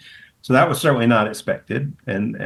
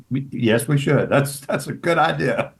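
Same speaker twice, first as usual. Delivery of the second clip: flustered agreement; 4 semitones higher than usual. Retold or imitated. Retelling her own flustered reply.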